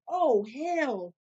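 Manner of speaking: loud exasperated cry; exclamation. Retold or imitated. Hungry worker reacting at work, loud exasperated cry. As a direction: blurt it out fed up. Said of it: "I'm like".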